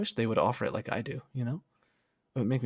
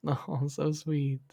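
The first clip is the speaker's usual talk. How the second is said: touched aww; 4 semitones higher, slower than usual. Real, not acted. Touched by chat.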